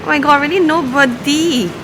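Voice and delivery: thrilled to have it to yourselves; delighted. Real, not acted. Delighted by the empty pool.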